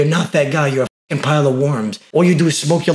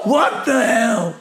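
high, very slow exclamation of disbelief; drawn-out shock; 8 semitones higher, much slower than usual. Real, not acted. Streamer startled by a caller's answer, drawn-out shock. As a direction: stretch each word out in high surprise. Startled reaction after laughing.

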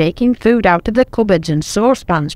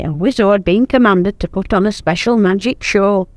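ordinary delivery, nothing marked

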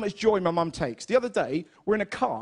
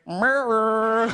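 mum's murr; 4 dB louder than usual. Retold or imitated. Mum answering a tea offer with a louder suggestive murr. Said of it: "looked at me and went".

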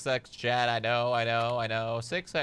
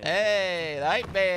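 hype; 8 semitones higher, 5 dB louder, much slower than usual. Real, not acted. Hypes along.

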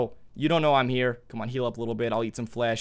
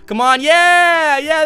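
loud, high, drawn-out cheer; triumphant cheer; 9 semitones higher, 8 dB louder, much slower than usual. Real, not acted. Player as the apple gets eaten, triumphant cheer. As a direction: urge it on, then cheer. Urges apple eating, then celebrates.

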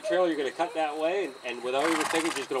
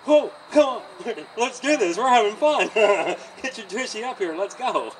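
much higher and louder, bubbly and laughing; energetic, playful coaxing with laughs; upbeat encouragement; 8 semitones higher, 11 dB louder than usual. Shown as a demonstration. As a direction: cheer the horse along like it's a game. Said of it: "take that nice positive attitude".

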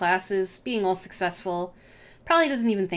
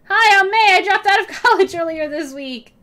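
loud, higher awkward intro; rehearsed greeting; 10 semitones higher, 9 dB louder than usual. Pretend. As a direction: blurt a perky intro. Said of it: "what are you gonna say?".

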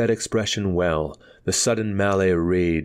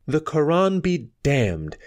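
higher, slower outburst of defiance; a bold, high, drawn-out exclamation; defiant exclamation; 7 semitones higher, slower than usual. A narrator's reading. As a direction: fling the curse out, high and slow. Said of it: "exclaimed Nana".

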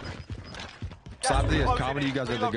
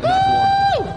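hype shout; 9 dB louder than usual. Pretend. Drawn-out hype announcement.

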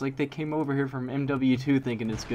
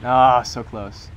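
frustrated groan; 10 dB louder, much slower than usual. Real, not acted. Narrowly missed knife shot.

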